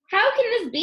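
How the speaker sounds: high, loud joyful wonder; king crying out, amazed at his own happiness. A narrator's reading. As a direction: burst out in happy amazement. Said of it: "he cried".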